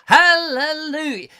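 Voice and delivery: high, loud, stretched preacher cry; American preacher. Pretend. Getting into the American accent, hammed-up preacher shout. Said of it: "the preacher man".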